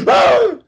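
very high, loud mock gasp of alarm; sudden loud gasp. Passenger gasps at driver in traffic, loudly mock-alarmed. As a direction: startle the driver with one big gasp. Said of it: "when I gasp for air".

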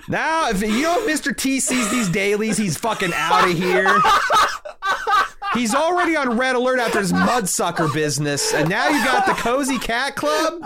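high-pitched comic alarm; worked-up mock rant, voice riding high; exasperated producer. Producer warning crew about Mister T, exasperated.

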